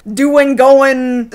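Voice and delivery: "doing, going" said as doin', goin'; dropped final G. Shown as a demonstration. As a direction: end "doing" and "going" on a plain N, no G. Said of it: "apostrophes cutting off the G".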